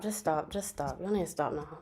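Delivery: quiet, spent pleading; fading out. Real, not acted. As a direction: let the voice sink away. Fan overwhelmed, trailing off.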